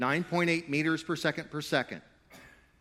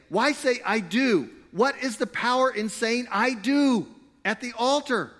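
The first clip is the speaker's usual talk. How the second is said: higher and louder, slow scoffing question; mocking skeptics; 10 semitones higher, 6 dB louder than usual. Preacher mimics cynics mocking wedding vows, scoffing. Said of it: "they say".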